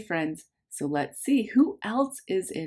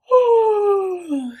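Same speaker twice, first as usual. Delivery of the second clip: tired sigh; 13 semitones higher, 8 dB louder than usual. Acted. Tired bear in the story.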